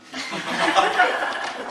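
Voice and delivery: breathy high laugh; a quick high laugh. Real, not acted. Comic laughing at his own setup, high laugh.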